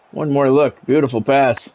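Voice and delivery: ordinary delivery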